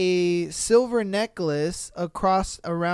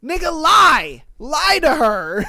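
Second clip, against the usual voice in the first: louder, slow emphatic plea; exasperated yell; 5 semitones higher, 6 dB louder than usual. Real, not acted. Comedian scolding the clueless boyfriend, slow loud yell. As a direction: spell it out loudly, pleading.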